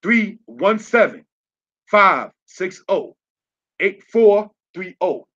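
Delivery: ordinary talk, nothing marked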